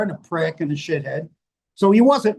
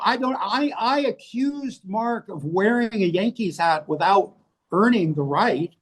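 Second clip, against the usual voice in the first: ordinary talk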